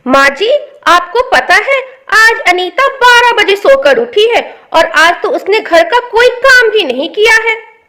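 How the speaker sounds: loud gossiping bahu; tattling daughter-in-law. Acted. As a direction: tell tales to the mother-in-law, loud and eager. Daughter-in-law telling tales to mother-in-law.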